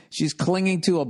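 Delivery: ordinary delivery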